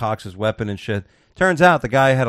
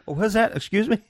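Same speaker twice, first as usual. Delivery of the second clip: mock operator; 9 semitones higher than usual. 911 operator voiced.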